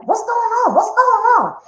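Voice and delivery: high, loud confused shouting; agitated yelling. Retold or imitated. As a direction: shout at the police in alarm. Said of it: "he was like".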